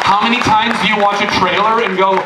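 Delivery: usual speaking voice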